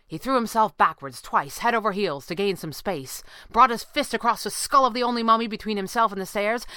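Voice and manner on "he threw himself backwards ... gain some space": ordinary delivery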